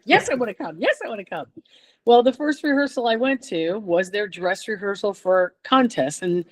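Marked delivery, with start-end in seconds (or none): 0.0-1.5 eager acceptance. Retold or imitated. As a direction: jump at the invitation with a swooping pitch. Acting out her eager acceptance.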